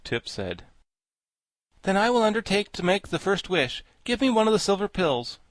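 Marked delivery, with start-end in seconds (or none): 1.8-5.4 put-on high-pitched boy character; higher, animated storybook lad; Tip, boy volunteering. A narrator's reading. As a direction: step up boldly as a boy. Narrator voices Tip.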